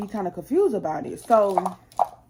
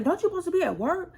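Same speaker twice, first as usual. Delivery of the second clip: much higher, fast accusing question; retold angry question; 10 semitones higher, faster than usual. Asking husband why he's not at work, high and fast. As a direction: rush the accusation out.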